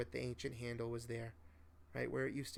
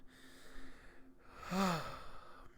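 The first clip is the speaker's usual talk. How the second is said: put-on long soft breathy old man's sigh; tired sigh; 6 semitones higher, 4 dB quieter than usual. A narrator's reading. Old man pausing mid-offer, a tired sigh. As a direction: let out a weary sigh. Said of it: "he said tiredly".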